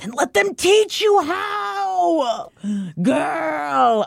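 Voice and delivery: high, slow exasperated yell; exasperated shout. Real, not acted. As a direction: shout it at the character. Exasperated cry at Nesta.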